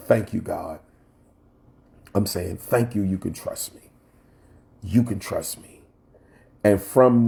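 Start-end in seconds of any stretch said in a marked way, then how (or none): 0.1-5.8 softly retold prayer. Retells quiet prayer while crying.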